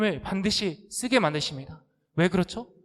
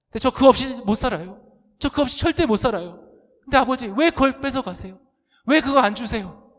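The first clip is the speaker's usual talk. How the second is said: louder, higher whiny demand; pleading complaint; 6 semitones higher, 5 dB louder than usual. Acted. Believer begs God for blessings, loud and whining. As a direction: push the begging up until it becomes a protest.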